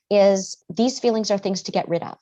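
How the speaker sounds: ordinary talk, nothing marked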